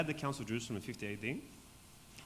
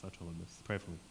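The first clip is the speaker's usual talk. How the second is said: softer, murmured to himself; quiet resolve; 5 semitones lower, 7 dB quieter than usual. Real, not acted. Losing his voice, he promises the class quietly. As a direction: say it again under your breath, resigned. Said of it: "anyone have lozenges or something?".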